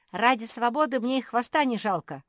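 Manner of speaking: high, loud and eager; reckless excited cry. A narrator's reading. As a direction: shout it with daring gusto. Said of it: "азартно выкрикнула Мафи".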